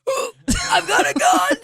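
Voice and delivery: high hammy gun boast; hammed-up tough guy. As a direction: ham up a cocky thug. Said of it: "he's like".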